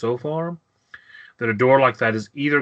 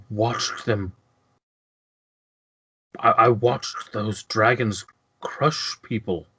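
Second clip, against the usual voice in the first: halting, haunted nobleman; long pauses, struggle to get it out; shaken lord. Acted. Trapped lord recounting the invasion to paladin, shaken. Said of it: "he's shaken".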